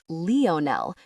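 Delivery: Leonel said lee-oh-NEL, stressed on the end; stress on final syllable. A narrator's reading. Counselor showing how the boy says Leonel, NEL stressed. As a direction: say Leonel the French way, punching NEL. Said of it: "with the emphasis on the Nell".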